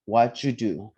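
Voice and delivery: "What you do" with "you" as "ju"; "What you do" linked through a j sound; pronunciation model. Shown as a demonstration. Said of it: "practice that sound".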